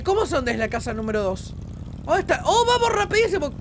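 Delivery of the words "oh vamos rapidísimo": high excited exclamation; thrilled at the speed. Real, not acted. Excited about fast ride. (emocionado, agudo)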